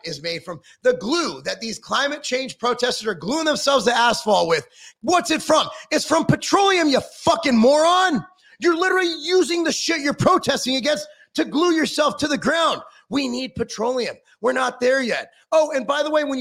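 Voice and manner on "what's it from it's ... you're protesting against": angry jab. Real, not acted. Ranting at glue-on climate protesters, angry and louder.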